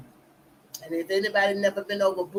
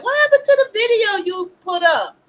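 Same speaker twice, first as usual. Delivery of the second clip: voicing her son, much higher and louder, alarmed question; son asking loudly; 12 semitones higher, 10 dB louder than usual. Son asking his mom where her video went, loud surprised voicing. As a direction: play the son bursting in, demanding answers. Son's quote voiced in story.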